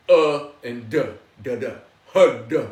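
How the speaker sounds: "Hud" split into "Uh" and "duh", tongue striking the roof of the mouth; sounds of Hud. Shown as a demonstration. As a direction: break "Hud" into breath, then a hard "duh". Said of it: "Three sounds".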